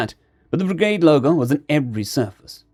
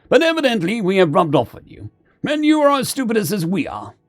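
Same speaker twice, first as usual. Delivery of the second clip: high-pitched commander, pitch falling at the end; Mori the commander; 7 semitones higher than usual. Acted. Commander telling the otters they have become alike, wry. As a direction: concede it as the commander.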